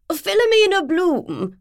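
slow, high, loud and put-out; impatient posh woman. Acted. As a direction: repeat it as if everyone should know. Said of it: "the woman repeated impatiently".

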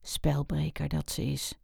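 hushed, voiceless whisper. A narrator's reading. Wouter to Mara about Hanny the spoilsport, whispered. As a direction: whisper it privately so she can't hear. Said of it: "fluisterde hij".

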